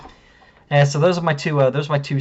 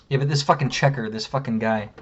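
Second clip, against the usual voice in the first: ordinary talk, nothing marked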